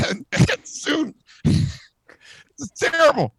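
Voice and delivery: high-pitched words squeezed out through laughter; helplessly losing it mid-read; breaking up laughing. Real, not acted. Reader cracking up at his own awful joke, breaking up laughing.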